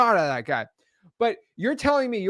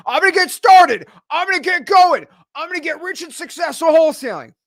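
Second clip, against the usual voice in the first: much higher, louder, pumped-up bragging voice; mock flexing; 11 semitones higher, 7 dB louder than usual. Host mocks wholesalers boasting before starting, hammed-up hype. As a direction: puff up like an empty hype man. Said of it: "everyone's all flexing".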